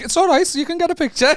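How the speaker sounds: high, smug mock voice brushing off a fan; mock big-shot reply. Comic mocks a celebrity brushing off a starstruck fan, in a high smug voice.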